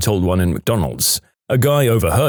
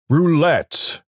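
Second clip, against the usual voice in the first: higher-pitched mock boom; 7 semitones higher than usual. Voice from above directs him in the casino, mock booming. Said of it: "booms the voice".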